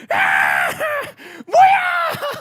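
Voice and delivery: high, loud shout of an outraged infant; comic baby yelling in protest. Retold or imitated. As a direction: shriek like a baby who got the wrong thing. Baby protests at father's breast.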